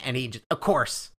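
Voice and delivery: usual speaking voice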